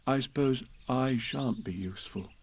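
soft, resigned, forlorn bear; sad. A narrator's reading. Pooh fears he won't be useful, sad. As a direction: give up glumly and quietly. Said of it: "said Pooh sadly".

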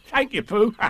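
high, chuckling tiger thanks; cheery storybook tiger; Tigger. A narrator's reading. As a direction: say thanks high and bouncy with laughs. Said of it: "said Tigger".

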